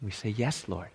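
guided meditation. Acted. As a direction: guide the listeners inward, hushed. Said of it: "lead us in a bit of an exercise".

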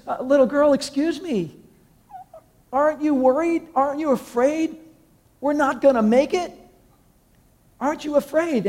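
high, anxious, pleading questions; a frightened passenger begging the calm girl for reassurance; panicked man. Retold or imitated. Man on the shaking plane asks the girl, high and panicky.

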